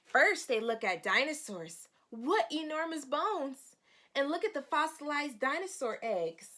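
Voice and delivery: put-on bright read-aloud voice for children; storybook reading. Teacher reading the storybook to her young students. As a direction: read the page like story time.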